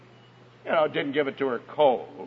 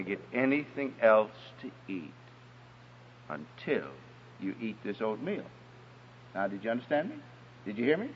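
softer, gentle mother voice, firm underneath; kind and sweet; 7 semitones lower, 6 dB quieter than usual. Retold or imitated. Preacher voices mother calmly telling daughter no food till oatmeal, softly. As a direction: soothe her kindly but hold the line. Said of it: "very kindly and sweetly said".

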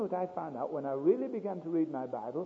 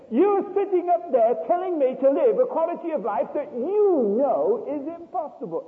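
much higher, loud, slowed indignant protest; exasperated outburst; 12 semitones higher, 9 dB louder than usual. Retold or imitated. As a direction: throw it back at God, fed up. Refusing God, exasperated.

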